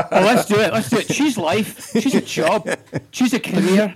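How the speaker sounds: thick Scottish brogue, high and punchy; Scottish Trainspotting monologue delivery; Scottish accent. Retold or imitated. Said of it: "do Ewan McGregor as William Wallace".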